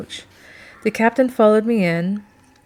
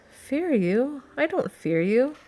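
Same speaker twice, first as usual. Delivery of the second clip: higher, lilting incredulous question; surprised denial; 7 semitones higher, slower than usual. A narrator's reading. Woman blinks at Duke's choice of words, surprised. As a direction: toss it back higher, with rising disbelief.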